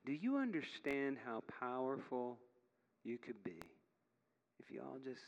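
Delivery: softly, with measured pauses, an earnest appeal; quiet pleading. Acted. God looking down at the churches, quiet pleading. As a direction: plead gently, letting each phrase fall away.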